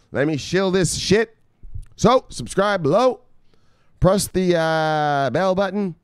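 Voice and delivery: hyped plug. Real, not acted. Hyping subscribe pitch.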